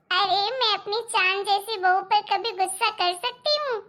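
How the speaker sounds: ordinary delivery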